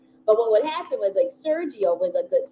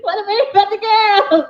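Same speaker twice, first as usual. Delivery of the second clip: put-on high, slow, loud childish whine; mock kid protest; 12 semitones higher, 8 dB louder, slower than usual. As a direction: play your indignant twelve-year-old self.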